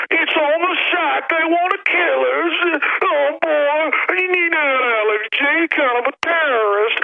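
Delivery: very high, slow mocking whine; mock victim whine. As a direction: ham up the frightened officer. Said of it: "They're saying".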